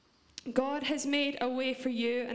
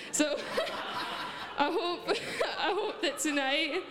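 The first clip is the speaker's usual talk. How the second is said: real laugh breaking through the words, higher; 5 semitones higher than usual. Real, not acted. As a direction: try to talk through a fit of laughter. Laughs after retelling remark on accent.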